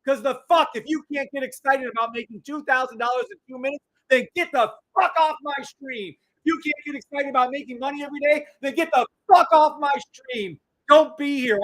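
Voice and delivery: high, heated rant; worked-up livestream tirade; passionate, angry yelling. Real, not acted. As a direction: tear into the doubters with fired-up passion. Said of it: "Why is he passionate like this?".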